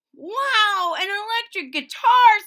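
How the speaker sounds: very high, loud thrilled exclaim; excited. Acted. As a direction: burst out with delight. Kids thrilled by instruments.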